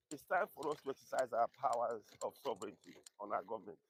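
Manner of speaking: usual speaking voice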